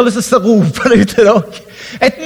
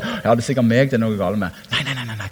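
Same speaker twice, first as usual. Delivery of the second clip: self-blaming mutter; 9 semitones lower, 7 dB quieter than usual. Retold or imitated. Imitating self-blaming believer.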